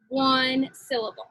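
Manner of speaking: normal talking voice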